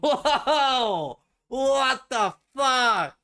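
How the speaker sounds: high, slow stunned yell; shocked shout. Real, not acted. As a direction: shout in sheer disbelief. Shocked by a pronunciation.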